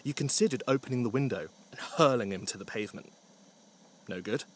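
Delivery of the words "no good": usual speaking voice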